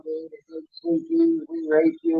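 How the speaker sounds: high, slow mocking voice of the angry owners. Mocking the angry owners the next morning, high and slow. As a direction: squeak it out like a mocked foreign complaint. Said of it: "They come in the morning".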